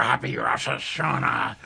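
hammy pirate growl on happy Rosh Hashanah. Pretend. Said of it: "Talk Like a Pirate Day".

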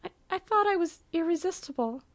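high, tearful stammering wail. Acted. Swanee crying to Uncle Osmond, high tearful voice. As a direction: sob it out, hurt and childlike. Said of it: "Swanee blinks back inky tears".

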